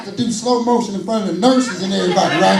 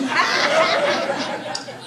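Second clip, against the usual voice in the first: breathy laugh; 7 semitones higher, 5 dB quieter than usual. Real, not acted. Laughing at own joke.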